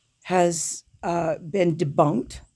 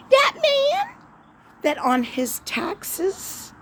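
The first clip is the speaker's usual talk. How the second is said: much higher, sarcastic falsetto character; squeaky high mock-innocent voice; mock naive character; 4 dB louder than usual. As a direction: play the wide-eyed fool in a sky-high voice. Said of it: "I get a little emotional".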